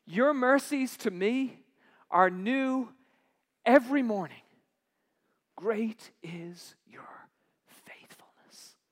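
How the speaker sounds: slow, fading to a whisper; tender quotation. Acted. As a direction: let the last words fall into a whisper. Quoting Lamentations tenderly.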